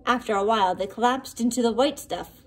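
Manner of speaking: ordinary delivery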